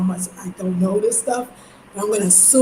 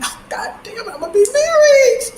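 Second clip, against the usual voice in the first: mock despair; 13 semitones higher, 4 dB louder than usual. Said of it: "mock despair at eating".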